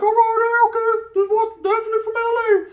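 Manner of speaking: squeaky high take on the new arrival; mock surprise. To himself, sizing up the new guy, in a high mock-surprised voice.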